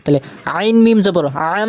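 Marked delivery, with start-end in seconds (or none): 1.6-1.7 "আম" with a long hummed m; sustained nasal close on "আম"; ghunnah nasal hold. Shown as a demonstration. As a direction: let the m of "আম" resonate in the nose. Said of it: "গুণনা, ঠিক আছে?".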